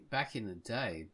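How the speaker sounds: ordinary talk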